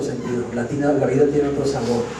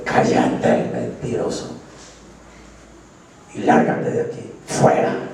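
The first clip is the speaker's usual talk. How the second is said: much lower, then breathy, voiceless command; rebuking the devil. Acted. Believer ordering the devil out, lower and stern. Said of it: "Dígale".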